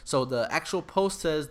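usual speaking voice

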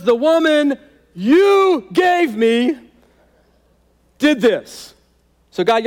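high, loud blame-shifting whine; whiny Adam. Acted. Adam telling God it's his problem. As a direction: shove the blame off and walk away. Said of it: "He goes".

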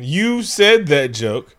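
slow mock complaint. Real, not acted. Mock outrage continues.

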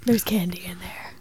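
soft creepy whisper. Pretend. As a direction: whisper it like a lure.

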